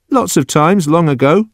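high-pitched, evasive simple man; reluctant Judson. Acted. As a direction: admit it in a high voice. Judson admits it.

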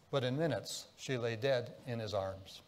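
ordinary delivery

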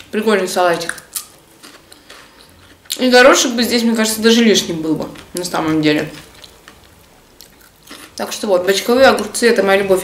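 muffled words around food; pausing to chew between phrases; mouth full, chewing. Real, not acted. Seen 2: Salad forkful at -1, cracker bite at 4.9, chewing throughout.

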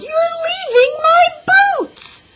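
very high, loud protesting wail. A narrator's reading. Little girl lifted out of her boots, wailing. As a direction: wail like a distraught child. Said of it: "she wailed".

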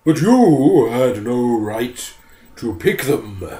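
slow, loud bear growl. Acted. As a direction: bear scolding the forester. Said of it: "growled the bear".

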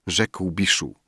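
ordinary delivery

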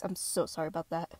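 ordinary delivery

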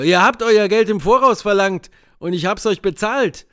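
the doctor objecting to the demand; indignant doctor. A narrator's reading. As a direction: protest sharply.

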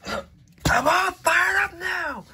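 high, loud, slow battle roar; fired-up shout. Acted. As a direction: bellow it, stretching every word.

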